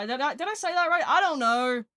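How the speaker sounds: nervous excited rush; flustered laugh-talk. Real, not acted. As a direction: blurt it out, flustered. Flustered about her French.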